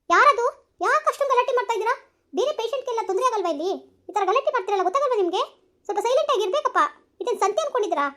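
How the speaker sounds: high, loud stern nurse; hospital nurse scolding the noisy family. Acted. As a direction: hush the family sharply.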